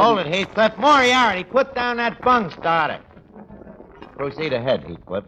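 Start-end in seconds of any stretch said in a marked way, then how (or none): 0.0-3.0 loud, urgent barked command; shouting order. Acted. Barkeep yelling across the room to stop a fight. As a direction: yell over a bar brawl to stop it.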